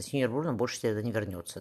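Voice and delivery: plain reading voice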